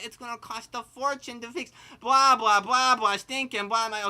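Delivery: parroting the upset friend's rant; mocking complaint.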